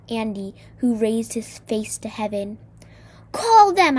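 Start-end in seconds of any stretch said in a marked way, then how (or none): 3.3-4.0 high, loud shriek. A narrator's reading. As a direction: shriek it out as an urgent order. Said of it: "I shrieked".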